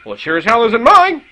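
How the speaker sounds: loud, offended snap back; indignant retort. Retold or imitated. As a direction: fire it back, insulted. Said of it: "my partner turns and says these words".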